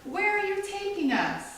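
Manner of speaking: grandma calling up at a gull; playful call to the sky. Acted. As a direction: tease the bird like a laughing grandma. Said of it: "laughing and shaking her fist".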